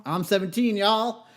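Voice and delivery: put-on loud, high Southern-accented holler. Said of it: "learned to do my Southern accent".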